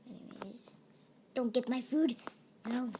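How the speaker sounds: usual speaking voice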